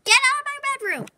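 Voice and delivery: fast, high get-out order. Pretend. Owner ordering the cats out of her bedroom, fast. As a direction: snap them out of the room quickly.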